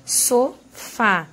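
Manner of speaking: "Sofá" split in two, so then FÁ, final syllable strongest; syllable-by-syllable stress. Shown as a demonstration. Teacher showing students the strongest syllable, "Sofá" split with stress on FÁ. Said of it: "Essa daqui é a sílaba mais forte".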